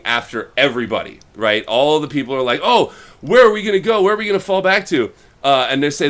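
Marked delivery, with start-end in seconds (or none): 2.6-5.1 higher, louder, fast panicky questions; a crowd scrambling in mock alarm. Panicked crowd asks where to go.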